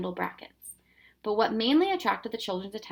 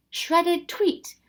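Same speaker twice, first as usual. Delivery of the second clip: jolly professor voice; 10 semitones higher, 5 dB louder than usual. Acted. Professor's punchline, high.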